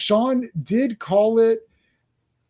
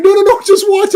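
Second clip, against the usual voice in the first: much higher and louder, pleading insistence; mock pleading friend; 13 semitones higher, 7 dB louder, faster than usual. Retelling friend Dan begging him to watch the episode, high mock pleading. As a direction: beg him like an eager friend.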